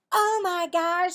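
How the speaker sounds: loud, slow, high shock; shocked gasp. Acted. Cassie seeing Marvin reach his elbow, loud shock. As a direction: catch him in the act.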